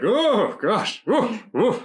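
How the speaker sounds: high, shivery gasps of a sudden chill; shuddering. Shown as a demonstration. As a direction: shudder through the words as if cold fear runs up your spine. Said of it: "those are shudders".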